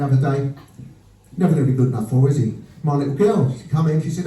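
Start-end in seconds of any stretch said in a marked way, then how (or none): none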